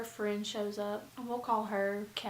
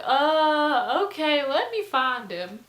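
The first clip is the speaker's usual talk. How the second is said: loud, higher mimicked drunk; drunk guy; 5 semitones higher, 11 dB louder, slower than usual. Drunk guest agreeing to find the host, lightly mimicked loud voice. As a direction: hesitate loudly like a tipsy guest. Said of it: "he's drunk and he's like".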